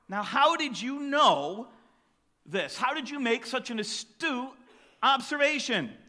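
a hint of an impressed inspector; curious detective. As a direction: draw out the wonder in each question. Detective asks how he knew.

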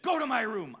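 loud, sharp command from a tough mother; mom's stern order. Retold or imitated. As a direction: order him to his room, firm and loud. Tough mom orders son.

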